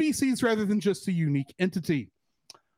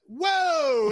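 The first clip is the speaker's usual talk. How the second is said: startled whoa; 8 semitones higher than usual. Retold or imitated. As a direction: let out a shocked whoa. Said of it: "I went".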